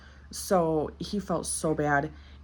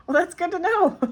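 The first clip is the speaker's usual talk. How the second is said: high, loud, laughing retort; mock relief; 13 semitones higher, 6 dB louder than usual. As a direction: squeal it as a wry comeback. Mock reply to alarming clipper news.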